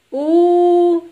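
high, loud, drawn-out "ooh"; long childlike hum while sizing someone up; child's pondering hum. A narrator's reading. Child considers collector's offer.

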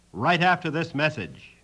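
ordinary delivery

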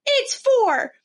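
mock-stern parents fixing "fur" to "for"; mimicking parents correcting. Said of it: "they were like".

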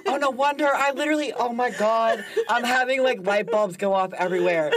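high-pitched, excited outburst with laughter; sudden realization. Real, not acted. Guest having light bulbs go off, high excited realization. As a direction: let the realization tumble out high and loud.

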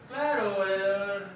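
slow, drawn-out working-class Mexican Spanish; working-class Mexican accent. Retold or imitated. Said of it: "El de clase baja es el". (con acento mexicano de clase baja)

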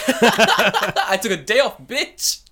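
high, slow, loud cackling gloat; laughing mock gloat. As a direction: crow it through laughter.

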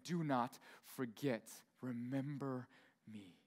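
slow, soft, low plea; quietly tender voice of Jesus; hushed Jesus. Acted. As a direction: let it fade to almost nothing.

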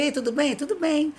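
fake friendly greeting. Faking recognition of a stranger.

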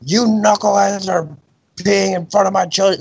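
retold angry dad. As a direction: imitate an irate dad telling off teenagers. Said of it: "flipped an absolute shit at us".

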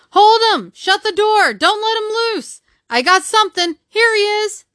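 high-pitched, urgent crowd cries; babel of shouts. A narrator's reading. Men fighting an unseen enemy, high excited shouts. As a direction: call out one frantic order after another. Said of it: "A perfect babel of noise".

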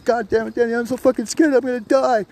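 high-pitched, frantic pleading; imitated scared friend. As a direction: act out a terrified friend pleading. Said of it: "He was so scared".

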